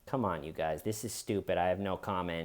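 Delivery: mock calm no comment; calm dismissal. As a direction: shrug it off quietly. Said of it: "just calmly walking out".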